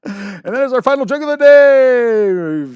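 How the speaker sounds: high, shouted sign-off; big, slow, very loud announcer call; segment announcer. Real, not acted. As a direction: belt it out and stretch the last word. Announcing end of joke segment.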